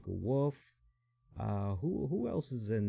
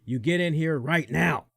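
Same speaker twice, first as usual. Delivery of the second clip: loud, high, shouted order; mock-yelling the referee's order at the top of his lungs; retold furious yell; 5 semitones higher, 10 dB louder than usual. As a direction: bark it out like an angry referee at full volume. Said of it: "just yelling at the top of his lungs".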